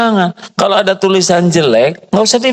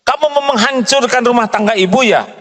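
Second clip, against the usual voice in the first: higher, angry accusing tone; angry demo; 10 semitones higher than usual. Shown as a demonstration. Mistress angrily accusing maid of wrecking home. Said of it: "Marah atau tegas?".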